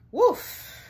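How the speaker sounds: high, loud puff of breath; relieved "whoof". Real, not acted. Reader reacts after the therapist's email, sharp exhale. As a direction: blow out a big "whoof".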